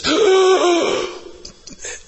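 long, high gasp; sudden sharp gasp, drawn out; loud imitated gasp. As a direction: imitate a startling sob-like intake. Imitates sudden sound after prayer.

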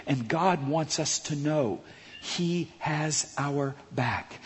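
slow, soft reassurance; reassuring. Real, not acted. Preacher assuring listeners of God, soft and slow.

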